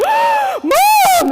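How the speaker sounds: sudden high piercing shout; a startled little boy shrieking for his mom. Retold or imitated. Six-year-old son shrieking to mom during Mass, piercing shout. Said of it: "my six-year-old son shrieked in the pew".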